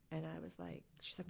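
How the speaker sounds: ordinary talk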